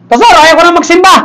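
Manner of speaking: high-pitched member's whine of protest; acted complaint. Member telling Pastor he quits church, mock complaining.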